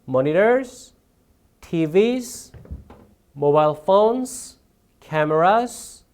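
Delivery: "Monitors, T Vs, mobile phones, cameras" each drawn upward, separated by pauses; rising list intonation. Shown as a demonstration. Teacher models parallel structure for test-takers, rising on "Monitors" through "cameras". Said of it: "올려주면 되겠죠?".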